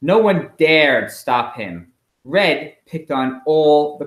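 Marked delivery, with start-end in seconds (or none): none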